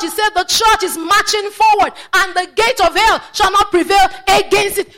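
high, loud, rousing sermon delivery; rally the church to march, driving it home; full-force preaching. Real, not acted.

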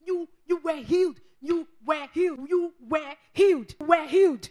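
loud preaching, each phrase punched; emphatic proclamation. Real, not acted. Preacher declaring healing to the congregation, emphatic proclamation.

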